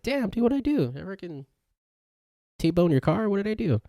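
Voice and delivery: high-pitched exaggerated pleading; mock dismay. Host replays his reaction to being ignored, in high mock dismay. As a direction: act out wounded bewilderment, voice shooting way up.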